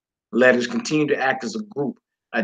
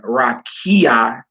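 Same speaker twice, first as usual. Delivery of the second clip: "rakia" with KI punched and raised; stress on ki. Shown as a demonstration. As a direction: lean on the middle ki of "rakia". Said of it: "is stressed on ki".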